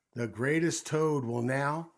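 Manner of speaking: loud stage proclamation; announcer voice. A narrator's reading. Voice begins another act for Toad, loudly announcing. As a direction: announce grandly, as if to a crowd. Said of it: "Then the voice said".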